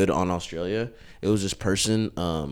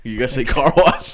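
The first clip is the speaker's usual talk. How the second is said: Aussie accent; 7 semitones higher, 8 dB louder than usual. Shown as a demonstration. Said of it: "that's how you practice the accent".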